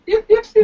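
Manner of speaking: high-pitched little yips; loud, playful fox yipping; imitated fox yips. Imitating a fox's yipping cry.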